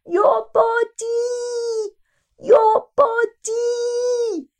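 high, drawn-out yell of a frantic teacher; teacher's scream. Retold or imitated. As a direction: bellow it across the arena. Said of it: "screaming at us".

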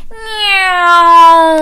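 high loud droning plane sound; aeroplane noise. Acted. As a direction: play aeroplane like a child. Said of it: "went Baby Bear".

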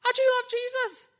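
high, lightly voiced little girl asking. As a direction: squeak it up like a small girl. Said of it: "And then some little girl".

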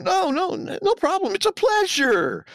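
hammed-up fawning assurance, stretching the last phrase; over-eager assuring. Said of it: "absolutely assures him".

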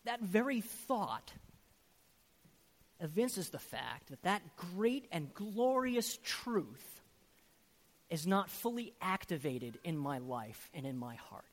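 normal talking voice

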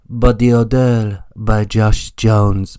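hammed-up European ad accent; European perfume-ad accent. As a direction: purr it like the end of a perfume advert. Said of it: "they always do the accent at the end, the European accent".

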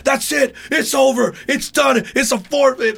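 very high, exasperated mock outburst; pissed-off umpire. Umpire ending the game, angry high-pitched imitation. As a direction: call the game off in a mock fit. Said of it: "The guy got all pissed".

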